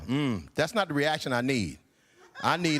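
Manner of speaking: ordinary talk, nothing marked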